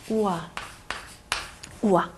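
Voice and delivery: 우아 sounded as a clear wa, gliding from u into a. Shown as a demonstration. Teacher models the oi sound for students, saying 우아 as wa. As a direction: say 우아 as one smooth wa glide. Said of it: "이런 발음을 해주셔야 돼요".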